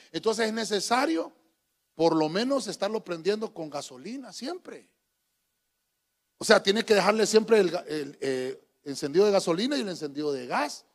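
normal talking voice

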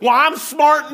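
mock a smug know-it-all, loud and high; mock smug churchgoer. Pastor mocking a churchgoer who thinks he's smart, smug shout. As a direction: shout it like a proud fool.

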